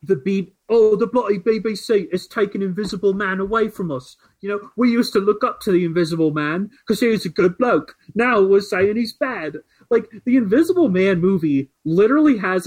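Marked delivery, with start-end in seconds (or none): 0.0-9.6 put-on British accent. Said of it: "this guy is like".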